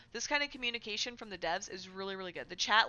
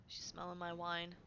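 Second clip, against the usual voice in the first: ordinary talk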